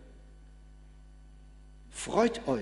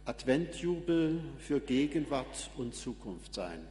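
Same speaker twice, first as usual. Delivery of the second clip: ordinary delivery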